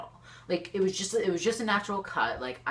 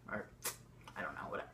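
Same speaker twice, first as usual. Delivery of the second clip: whispered aside; 8 semitones lower, 16 dB quieter, much faster than usual. Real, not acted. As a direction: whisper it, much softer and lower. Dismissive aside.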